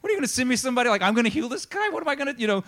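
high-pitched flustered protest; upset king. Retold or imitated. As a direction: act out a king in a tizzy. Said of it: "he gets really upset".

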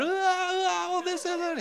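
put-on very high childish whine; mock whining believer. Believer whining at God like a toddler, high whine. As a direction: whine to God like a fussy kid.

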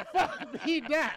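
mock chant dissolving into laughter; laughing jeer. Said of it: "we gonna be like".

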